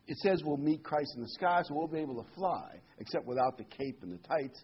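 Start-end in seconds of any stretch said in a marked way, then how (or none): none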